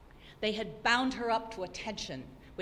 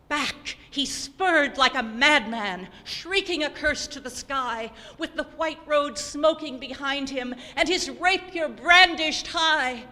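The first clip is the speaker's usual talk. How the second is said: fierce crescendo; 6 semitones higher, 10 dB louder than usual. A narrator's reading. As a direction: thunder it out like his furious ride. Highwayman's mad ride back.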